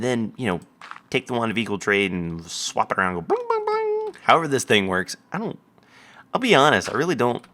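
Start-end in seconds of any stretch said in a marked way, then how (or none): 3.2-4.1 squeaky high sound effect; high bling sound effect. Retold or imitated. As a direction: chirp it like a magic sparkle. Imitating wand sound.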